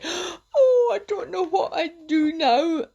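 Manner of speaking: high, tearful wail, imitated; imitating her crying. Retelling the girlfriend crying to her boyfriend, mock tearful wail. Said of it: "she started to cry".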